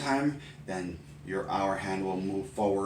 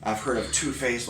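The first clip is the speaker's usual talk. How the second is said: usual speaking voice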